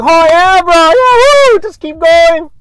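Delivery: high, loud sarcastic cheering; mock cheering. Surfer mocking the guy who dropped in, high loud fake cheer. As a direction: cheer him on with fake hype, clapping. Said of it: "this is the passive aggressive me".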